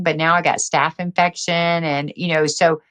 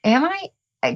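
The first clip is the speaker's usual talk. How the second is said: higher, innocent mock surprise; 10 semitones higher than usual. Patient doubting her doctor's verdict. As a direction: act lightly caught off guard. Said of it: "And I'm going".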